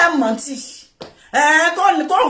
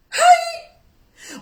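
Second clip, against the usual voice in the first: mock yelp. Mock cry during the story.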